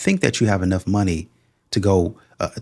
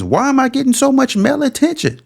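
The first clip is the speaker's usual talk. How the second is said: mock confused woman; 4 dB louder than usual. Mocking woman's fake confusion.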